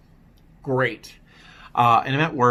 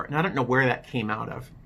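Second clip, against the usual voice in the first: normal talking voice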